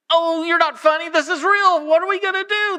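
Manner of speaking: high, loud worried cry; panicked disbelief. Retold or imitated. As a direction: insist it is real, alarmed.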